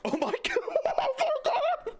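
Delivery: high, breathless shrieks of laughter, close to tears; hysterical laughing. Real, not acted. Gamer startled by a scary sound, laughing hysterically. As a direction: laugh in shock until it almost turns to crying.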